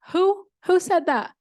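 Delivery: loud, high-pitched startled question. Acted. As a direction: call out in surprise, searching for the speaker. Startled animals look for the speaker.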